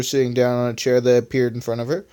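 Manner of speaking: plain reading voice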